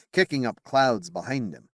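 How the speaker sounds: ordinary delivery, nothing marked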